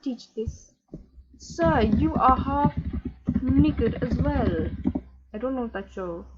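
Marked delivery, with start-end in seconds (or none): 1.4-4.7 slow, loud telling-off; slow scolding. Acted. To Marcus in ripped jeans, a slow loud telling-off. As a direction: spell it out to him slowly.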